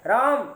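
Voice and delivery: राम called out, vowel held; calling out. Shown as a demonstration. Teacher calls to a distant boy, a held call. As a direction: call राम to someone far away. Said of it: "कोई लड़का बहुत दूर है".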